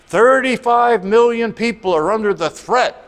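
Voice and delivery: mock the doom-laden weather report, punchy and loud; dramatic newscaster. Mock weather announcer alarm.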